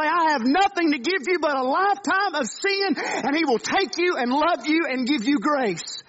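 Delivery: preaching at full force, rising with conviction; impassioned preaching. Acted. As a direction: lift the voice and press the good news home.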